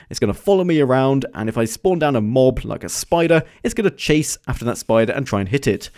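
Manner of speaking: usual speaking voice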